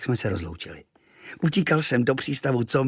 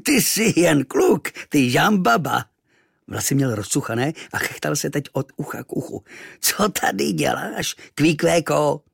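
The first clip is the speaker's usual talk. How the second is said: laughing giant; 4 semitones higher, 3 dB louder than usual. Acted. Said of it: "rozchechtal se".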